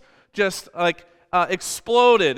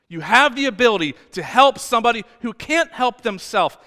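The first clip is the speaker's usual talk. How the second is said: loud; 5 semitones higher, 6 dB louder than usual. Real, not acted. Shouted rebuke in sermon.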